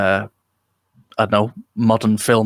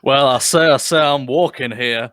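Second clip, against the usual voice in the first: mild mock Southern rooster bluster, louder; Foghorn Leghorn; 2 semitones higher, 4 dB louder than usual. Showing their American accent always becomes Foghorn Leghorn, mild mock Southern bluster. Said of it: "ends up being Foghorn Leghorn".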